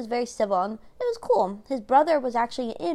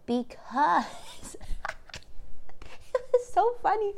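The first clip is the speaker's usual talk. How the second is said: laughing; 7 semitones higher, slower than usual. Real, not acted. Laughing while recalling the show.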